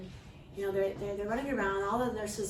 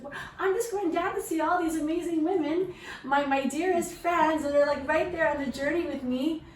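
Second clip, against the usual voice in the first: high, loud, gushing excitement; eager self-talk; 8 semitones higher, 7 dB louder than usual. Acted. Speaker telling herself how she joins her women friends, gushing. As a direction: gush about your dearest friends, pitched up and loud.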